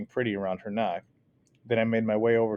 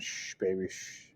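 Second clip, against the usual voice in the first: soft, lower hushing; calm the crying baby in a sing-song; man hushing a crying baby; soothing hush; 3 semitones lower, 9 dB quieter, slower than usual. Acted. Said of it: "went straight into a bouncy sing-song".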